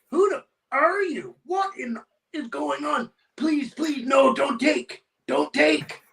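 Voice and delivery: high-pitched imitation of a panicked man pleading; alarmed newcomer. Startled tenant begs the man in high-vis, imitated alarm. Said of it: "new Canadian fellow with rough English".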